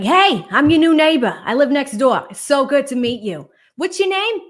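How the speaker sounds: loud, high Boston neighbor greeting; Boston accent. Retold or imitated. New neighbor at the door introducing herself, Boston accent. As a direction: call it out in a thick Boston accent. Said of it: "best example of a Boston accent".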